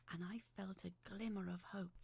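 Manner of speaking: ordinary delivery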